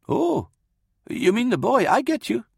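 put-on high-pitched old man's voice; old priest. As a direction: realise what was meant. Old priest catches Dorden's meaning.

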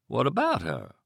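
grotesque, teasing question. Acted. Kidnapper taunting a terrified husband about his wife, teasing. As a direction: dangle it in front of him, mocking. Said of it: "The teasing tone was grotesque".

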